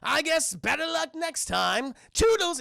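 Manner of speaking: high, slow mock-polite villain; hammed-up singsong taunt; Joker. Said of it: "It's like".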